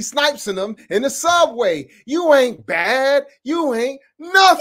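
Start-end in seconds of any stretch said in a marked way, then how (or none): 2.0-4.6 high, loud mocking Jackson taunt, rising at the end; mocking the teachers with a Jackson-style taunt, high and loud; [name] impression. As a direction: sneer it like [name] in the [name] video. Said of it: "They sound like [name] in the [name] video".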